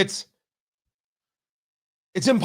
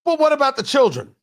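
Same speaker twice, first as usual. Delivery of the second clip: mock objection; 6 semitones higher than usual. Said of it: "You can't say to me".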